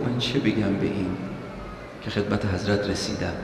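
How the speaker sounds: a hushed aside, as if not wanting to boast; said quietly. Retold or imitated. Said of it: "آهسته گفت". (آهسته و زیر لب)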